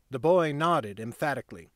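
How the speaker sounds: usual speaking voice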